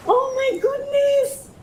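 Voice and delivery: very high, slow squeal; overwhelmed. Real, not acted. Vlogger still marvelling at her pot, squealing. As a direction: squeal it out, overcome with joy. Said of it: "I feel like I'm gonna cry".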